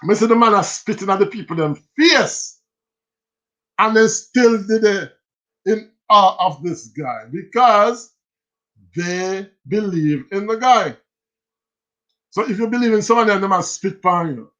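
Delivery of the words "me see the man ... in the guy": normal talking voice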